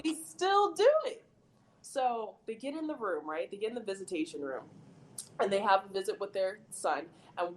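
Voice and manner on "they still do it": "still do it" pitched way up and louder; high, loud, amazed stress on "still do it"; incredulous emphasis. Real, not acted. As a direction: repeat it in high disbelief, hitting "still do it". Repeats an absurd point amid laughter.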